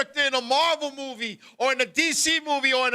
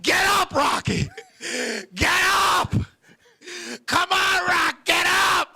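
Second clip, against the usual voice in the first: higher, louder, urging shouts; shouting encouragement; 6 semitones higher, 3 dB louder, slower than usual. Retold or imitated. Smart-ass kid yells at his knocked-down friend, urging him up. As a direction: yell at the fallen boxer to get up. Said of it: "He was like".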